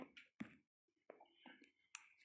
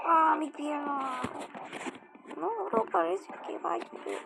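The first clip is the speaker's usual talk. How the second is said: pained yelling; 4 semitones higher, 9 dB louder than usual. Pretend. Hurt in the game, yelling.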